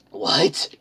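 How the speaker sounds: high, loud, startled question; incredulous. Real, not acted. Reader thrown by a crude line in the fanfic, incredulous.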